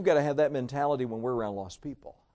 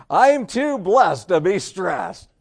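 slow, loud and higher, emphatic; loud declaration; 4 semitones higher, 8 dB louder, much slower than usual. Acted. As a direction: proclaim it slowly over car trouble. Declares slogan over car trouble.

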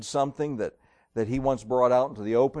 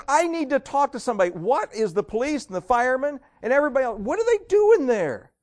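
very high outburst, building louder; exasperated boss; 12 semitones higher, 5 dB louder than usual. Acted. Boss demands an adult from kid, exasperation building. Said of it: "The boss is really getting irritated".

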